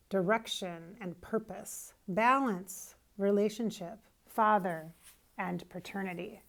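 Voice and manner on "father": ordinary talk, nothing marked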